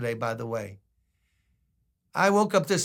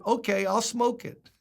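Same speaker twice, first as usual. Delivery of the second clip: street man's cheeky reply; 7 semitones higher than usual. Retold or imitated. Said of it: "He said".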